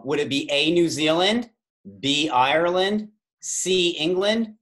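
normal talking voice

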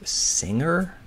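"Singer?" rising high at the end; unsure question. Real, not acted. Guessing a crossword clue aloud, "Singer?" asked rising. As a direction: offer "Singer?" as a doubtful guess. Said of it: "said it as a question".

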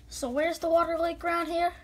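normal talking voice

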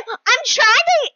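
high squeal into a loud strained start; holding back screaming. Real, not acted. Player trying not to scream, high strained outburst. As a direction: fight down a scream. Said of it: "keep myself from not screaming".

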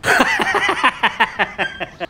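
real high laughter. Real, not acted. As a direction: burst out laughing. Laughs at pupil's trick.

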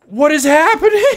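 high, loud, laughing bewilderment; baffled outcry. Real, not acted. Streamer reacting to a baffling drawing, high and bewildered. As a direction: cry out in disbelief, tipping into laughter.

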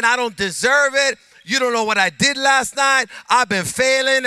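a touch of a guilty believer; voicing a doubter. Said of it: "you might be thinking".

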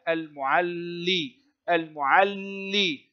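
slow المعلي with a held double l; teacher drawing out the shadda in المعلي; doubled lam. Shown as a demonstration. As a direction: hold the l in المعلي, then release into "li". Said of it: "حرف اللام مشددا ينطق بحركتين".

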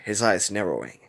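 ordinary delivery, nothing marked

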